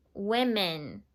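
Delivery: "Women" said softly, first vowel short i; quiet model of "Women", wi-men. Shown as a demonstration. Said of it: "Not women's".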